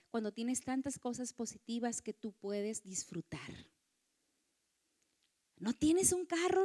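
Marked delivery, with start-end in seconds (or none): 0.1-3.7 soft whisper; hushed reassuring whisper. Real, not acted. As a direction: whisper it tenderly.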